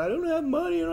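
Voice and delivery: very high whiny put-on voice; mock whining girl. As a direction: whine it like a begging girl. Girl whining about money.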